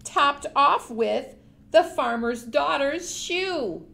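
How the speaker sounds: usual speaking voice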